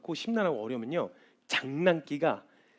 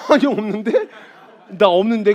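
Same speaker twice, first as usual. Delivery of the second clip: laughing denial; 6 semitones higher, 13 dB louder than usual. Retold or imitated. Laughing denial to worried kids.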